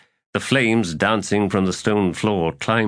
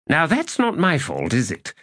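high-pitched indignant retort; defensive protest; 8 semitones higher than usual. A narrator's reading. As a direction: protest your innocence in a raised pitch. Tehol defends himself.

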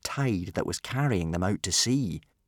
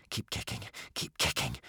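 unvoiced, hushed urging; whisper; 4 semitones lower, 9 dB quieter than usual. A narrator's reading. Boy urging swimmers on warily, whispering. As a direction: whisper it so nothing below hears. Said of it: "whispered Hiccup".

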